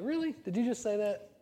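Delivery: high, disbelieving mock outrage; incredulous. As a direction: act offended and amazed. Mocking a passive-aggressive remark.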